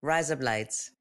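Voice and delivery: put-on Aussie accent, soft; softly twisted Australian vowels. Shown as a demonstration. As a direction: repeat it in a mock Australian accent. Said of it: "Can you say it again".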